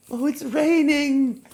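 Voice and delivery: high, slow, loving sigh of joy; rapturous oh it's raining; delighted. Shown as a demonstration. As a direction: savor the rain like a treat. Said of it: "what about if you love the rain".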